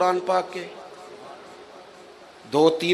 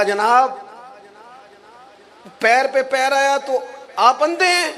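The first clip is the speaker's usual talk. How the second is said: put-on angry old man; 10 semitones higher than usual. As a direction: rise up in outrage at being stepped on. Woken old man scolds the caliph. (پیر پہ پیر آنے پر بزرگ کا اونچا غصیلا سوال)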